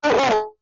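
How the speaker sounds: high victorious cry; triumphant shout. Retold or imitated. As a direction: let out a war cry. Celebratory cry after win.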